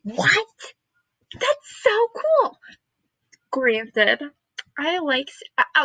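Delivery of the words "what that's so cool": high-pitched gasp of delight; amazed squeal. Retold or imitated. Student reliving amazement at serial killers class, excited squeal. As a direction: draw out a high, thrilled reaction.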